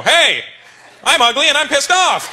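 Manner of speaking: loud, high-pitched mock outrage; drawn-out indignant yell, hammed up; offended shout. An ugly person taking offense, mock outraged shout. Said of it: "gonna get offended and go".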